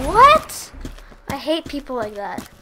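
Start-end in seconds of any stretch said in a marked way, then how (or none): 0.1-0.7 high, loud stunned what; shocked. Real, not acted. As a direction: blurt out in disbelief. Shocked mid game.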